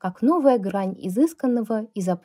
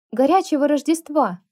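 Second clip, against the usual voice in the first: higher, louder cheeky call; shouted after her; 7 semitones higher, 5 dB louder than usual. A narrator's reading. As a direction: call it out after someone leaving. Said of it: "крикнула я вслед Шерил".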